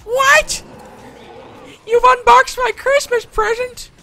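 outraged son. Acted. Billy yelling at the person who unboxed his Christmas present, high and loud. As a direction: scream like a kid robbed of his gift.

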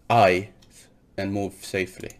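ordinary delivery

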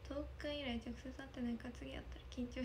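soft whispered read; a hushed, shy little whisper. Real, not acted. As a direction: breathe the words out shyly. Reading a shy comment aloud.

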